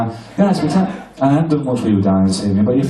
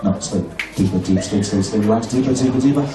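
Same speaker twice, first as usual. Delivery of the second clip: hypnotic sleep command; 4 semitones lower than usual. Acted. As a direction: lull them down into sleep.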